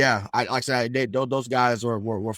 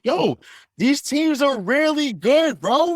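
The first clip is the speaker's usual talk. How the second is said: excited; 12 semitones higher, 5 dB louder, slower than usual. Real, not acted. Hyped praise of the playoff game.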